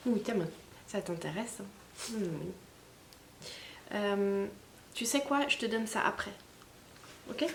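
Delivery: soft, gentle voice to a pet; talking to a dog. Real, not acted. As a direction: coax the dog softly. Talking softly to her dog.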